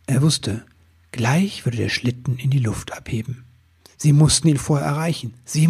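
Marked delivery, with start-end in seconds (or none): none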